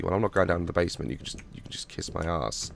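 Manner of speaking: ordinary talk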